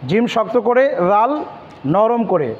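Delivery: ordinary talk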